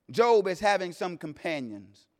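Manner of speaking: normal talking voice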